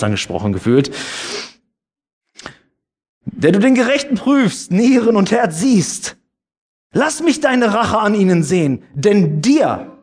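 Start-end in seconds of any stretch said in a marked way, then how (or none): 3.2-10.0 raised, higher solemn reading of the Bible verse; scripture reading. Acted. Pastor to the congregation, reading Römer with raised solemn voice. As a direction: read it out to the congregation like proclaiming scripture.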